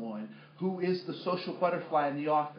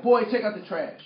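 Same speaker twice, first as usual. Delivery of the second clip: loud, higher dad giving an order; raised-voice imitation of a commanding dad; stern father; 7 semitones higher, 10 dB louder than usual. Dad orders his teenage son to do chores, stern. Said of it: "He'd be like".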